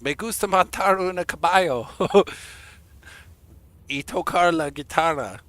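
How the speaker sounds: playful mock show-off in Spanish; jokey Spanish. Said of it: "throwing stuff out there to try and be funny".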